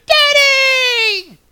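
high piercing shout of Daddy; kids screaming. Retold or imitated. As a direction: yell it like a child running down the hall. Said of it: "They go screaming".

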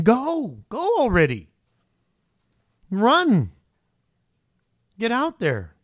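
high, loud commands; exasperated urging. Acted. Preacher to someone begging God for an answer, exasperated urging. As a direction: push them out the door with each word.

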